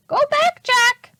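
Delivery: very high, loud parrot voice; squawking parrot. A narrator's reading. Polly the parrot warning the children, high squawk. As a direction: screech it like a parrot. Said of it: "she said".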